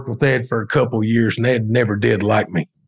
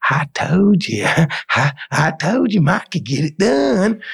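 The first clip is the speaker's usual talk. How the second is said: whispered start, then a higher odd put-on voice; Kelly's way of talking; 6 semitones higher than usual. Teller imitates his boss bragging about him, light impression. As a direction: lightly mimic the boss's peculiar voice. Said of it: "that's the way he talked".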